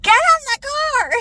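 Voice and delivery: high, loud yelled order; screaming. Retold or imitated. Mom yelling at son to get in car, screaming. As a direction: scream it in frustration. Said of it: "Why are you screaming at me?".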